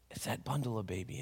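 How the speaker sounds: hushed unvoiced wondering; whisper. Papa at the window wondering about the bundle, whispered. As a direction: whisper it to yourself.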